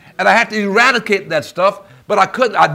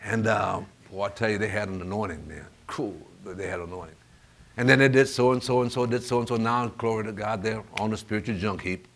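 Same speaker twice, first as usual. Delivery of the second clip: low, soft, hesitant churchgoer; hushed, low imitation of a sceptic; imitated doubter; 6 semitones lower, 9 dB quieter than usual. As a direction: mimic a doubting brother, quiet and low. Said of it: "you might start thinking".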